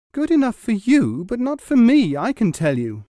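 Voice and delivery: high, loud angry retort; loud high furious wife. A narrator's reading. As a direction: snap back at the husband in anger. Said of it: "she replied angrily".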